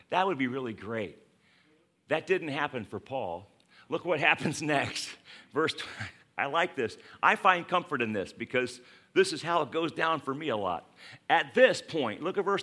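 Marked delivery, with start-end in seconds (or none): none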